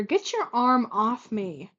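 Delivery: slow, heavy, biting order; a slow menacing snap; snapped. Acted. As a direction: drag each word out in anger. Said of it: "Gladion snapped".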